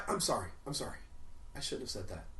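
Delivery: normal talking voice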